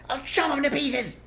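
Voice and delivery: high, fast shouting farmer; quick loud high threat; shouted. Acted. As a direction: bark the threat. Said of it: "shouted Bunce".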